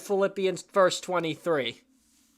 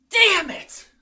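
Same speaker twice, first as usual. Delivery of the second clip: high frustrated yell, sharp and loud; frustrated outburst; 10 semitones higher, 4 dB louder than usual. Real, not acted. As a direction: blurt out a curse in frustration. Trying so hard not to swear.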